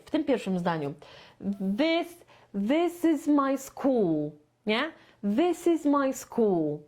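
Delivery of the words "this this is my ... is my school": "school" falls at the end, slow; falling on school. Shown as a demonstration. Teacher marking where intonation falls, pitch drops on school. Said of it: "intonacja idzie w dół tutaj".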